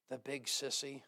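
put-on soft, low child's grumble; lightly shaken voice. A narrator's reading. Small son grumbles about his father, slightly shaken. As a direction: mutter it low, a touch shaken. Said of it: "broken by a shaken voice".

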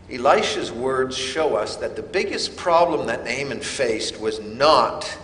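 ordinary delivery

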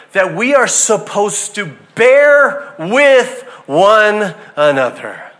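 slow, loud, drawn-out proclamation; emphatic preaching. Shown as a demonstration. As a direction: hammer each word out to the church. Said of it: "That's what we're supposed to do".

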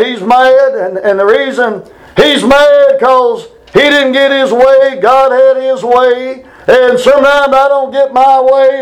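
rising, slow preacher's build; preaching. Acted. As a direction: drive the point home from the pulpit. Preaches about Jonah's anger.

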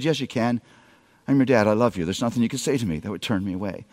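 soft, low, fast comforting dad; a father soothing his daughter at night; tender reassurance. Retold or imitated. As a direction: coax her gently to open up.

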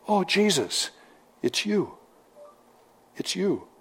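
hushed, fading wonder at recognizing him; tender recognition. Retold or imitated. Preacher voicing a believer hearing Jesus, soft and tender. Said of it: "that's the way it should be when Jesus speaks".

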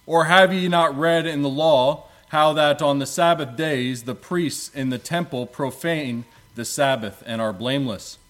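ordinary talk, nothing marked

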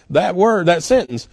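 usual speaking voice

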